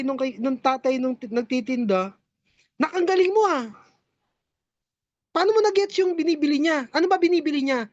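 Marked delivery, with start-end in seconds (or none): 2.8-7.9 impressed praise. Acted. Father praises child, higher.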